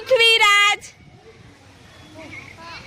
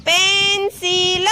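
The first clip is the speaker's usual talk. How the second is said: slow sounding out; much slower than usual. Shown as a demonstration. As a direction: stretch pencil out slowly, sound by sound. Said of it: "આપણે બોલીએ તો પહેલા શું બોલીએ?".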